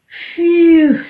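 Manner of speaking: a big loud exhale of relief; loud tired phew. Acted. Goat tired out after games, loud phew. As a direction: blow out a loud weary phew. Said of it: "I am all tired out".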